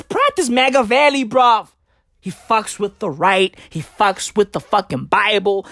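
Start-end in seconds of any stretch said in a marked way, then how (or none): none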